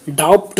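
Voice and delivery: "डाउट" with the B wrongly sounded as P; mispronounced silent B. Shown as a demonstration. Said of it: "नहीं बोलते हैं". ("डाउट" में silent बी का गलत उच्चारण, "डाप्ट" जैसा)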